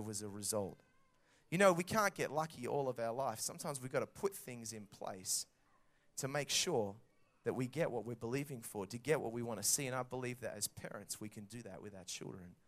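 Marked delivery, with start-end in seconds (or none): none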